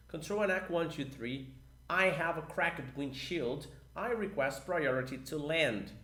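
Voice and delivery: slow English radio call read aloud together; full radio call demo. Shown as a demonstration. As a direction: lead the class through the call slowly. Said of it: "vamos comigo".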